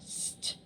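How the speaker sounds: "st" as a bare hiss into t, no vowel; isolated blend sound. Shown as a demonstration. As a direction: sound out "st" alone.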